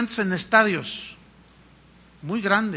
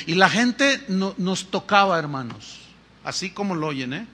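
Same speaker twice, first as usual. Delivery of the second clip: normal talking voice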